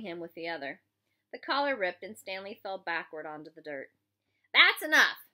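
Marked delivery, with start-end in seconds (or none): 4.5-5.3 loud, high command to stop; raised-voice order; yelled. A narrator's reading. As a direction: yell to break up a fight. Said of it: "Mister Pendanski yelled".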